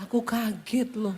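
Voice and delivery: soft Solo husband. Retold or imitated. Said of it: "nadanya turun terus".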